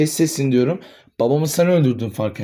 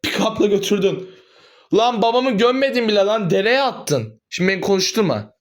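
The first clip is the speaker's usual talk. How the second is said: angry accusation; 8 semitones higher than usual. Acted. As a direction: throw the accusation back at her. Son accuses mother heatedly.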